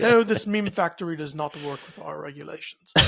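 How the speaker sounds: light mock British accent, prim and proper; a buttoned-up British regulator, in a hint of a British accent; lightly put-on British accent of a stuffy official. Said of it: "that's my British accent".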